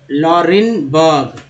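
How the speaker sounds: लॉरेनबर्ग drawn out in two parts; careful stretched लॉरेनबर्ग; slow word demo. Shown as a demonstration. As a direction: sound out लॉरेनबर्ग slowly. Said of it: "इस तरह से आप पढ़ना सीख".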